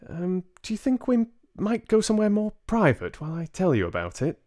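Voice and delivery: put-on an elderly grandmother being tactful; voiced grandmother. Grandmother asking the parents for privacy, polite old lady voice.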